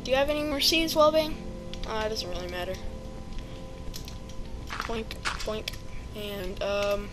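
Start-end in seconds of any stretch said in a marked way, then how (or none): none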